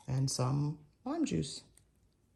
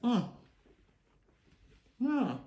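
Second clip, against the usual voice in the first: ordinary delivery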